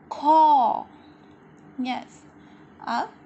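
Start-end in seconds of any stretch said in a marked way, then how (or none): 0.1-0.9 loud, clear model of खौ with an open au; syllable demo. Shown as a demonstration. Teacher modelling खौ loudly for kids. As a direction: belt out खौ for the class. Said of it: "इसे कैसे उच्चारित करेंगे?".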